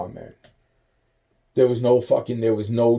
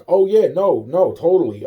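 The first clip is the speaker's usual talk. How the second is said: mock breezy agreement; 7 semitones higher, 8 dB louder than usual. Mocking the staff's breezy reassurance.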